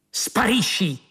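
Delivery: sharp, high barked order; shout. Acted. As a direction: bark it at the young guard. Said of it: "urlò Gels".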